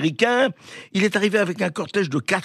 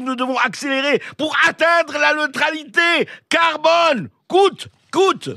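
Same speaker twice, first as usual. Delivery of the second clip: put-on German accent; 10 semitones higher than usual. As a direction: bark it like a caricature German official. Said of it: "Je vais essayer de prendre l'accent allemand, je le prends mal".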